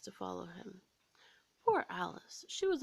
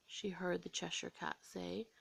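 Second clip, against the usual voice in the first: plain reading voice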